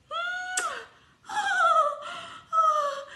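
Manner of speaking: high, swooning "hum, oh, oh"; soupirs ravis. Acted. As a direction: gasp and coo loudly with delight. Donkey swoons after the kiss. (petits cris de ravissement aigus)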